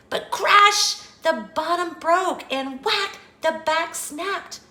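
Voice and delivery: high, excited storytelling; dramatic crash. A narrator's reading. Telling how the little chair broke, dramatic alarm. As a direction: punch out each break high and dramatic.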